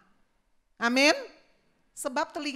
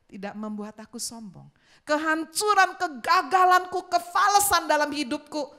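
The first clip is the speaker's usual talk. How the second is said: surrender prayer; 5 dB louder than usual. Acted. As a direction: pray it out, building and letting go.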